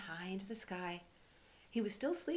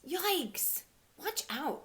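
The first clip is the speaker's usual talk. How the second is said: much higher and louder, squeaky alarm; little rabbit yelping a warning; high squeaky little rabbit crying out; 11 semitones higher, 7 dB louder, much slower than usual. Acted. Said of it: "cried the little rabbit".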